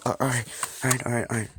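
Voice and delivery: fast, high flustered agreeing; scared principal giving in; nervous babble. Acted. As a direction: gabble yes to calm the threat.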